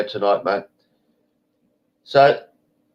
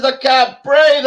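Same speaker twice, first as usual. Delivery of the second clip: imitating the woman; 13 semitones higher, 6 dB louder, slower than usual. Woman answers in retold quarrel.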